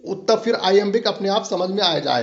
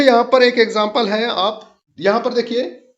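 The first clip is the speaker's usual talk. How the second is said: ordinary delivery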